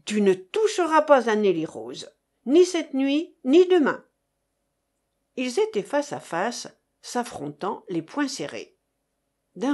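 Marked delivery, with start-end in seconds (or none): 0.0-4.0 firm, then louder on each ni; forbidding, growing to a near-shout; rising anger. Acted. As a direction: lay down the law, building to a shout. Forbidding rival, building.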